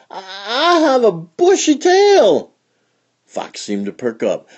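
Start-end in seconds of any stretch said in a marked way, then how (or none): 0.1-2.5 high stammering fox voice; hopeful stammering fox; nervous fox. A narrator's reading.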